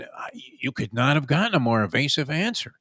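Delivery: ordinary delivery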